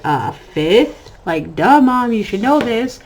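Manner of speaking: sassy duh. Retold or imitated. Son sassing his mom for not knowing his grade. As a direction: sass your mom like she's clueless. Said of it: "And he's like".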